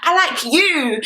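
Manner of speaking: mock pleading, pitched high; mimicking someone's wounded innocence; playing woe-is-me. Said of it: "you started playing woe is me".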